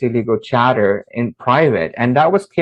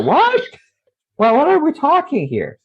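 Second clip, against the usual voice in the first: incredulous squeak. Retold or imitated. Baffled boy to the girl talking about the jinn, incredulous squeak. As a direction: pitch leaps way up on a stunned what.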